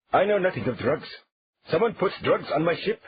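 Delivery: put-on high, loud accented protest that doesn't convince; a nervous captain swears he's innocent; solemn, nervous Turkish captain. Captain denies the drugs, nervous and unconvincing. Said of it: "solemnly, but nervously and unconvincingly protested".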